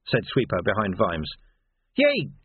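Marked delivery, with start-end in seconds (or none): 2.0-2.3 high, exasperated yelled oath. A narrator's reading. Vimes flinging his sword to the ground, yelling. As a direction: yell it out in sharp exasperation. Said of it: "yelled Vimes".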